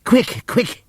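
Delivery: high, urgent call; frantic donkey shouting for help. Acted. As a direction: shout it in a panic. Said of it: "he shouted".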